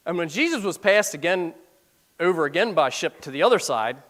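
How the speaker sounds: reading the verse aloud, measured; scripture reading. Acted. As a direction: read it like scripture from the pulpit.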